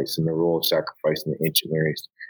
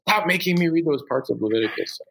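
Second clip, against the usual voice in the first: mock yell; 10 semitones higher than usual. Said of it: "she keeps yelling at me".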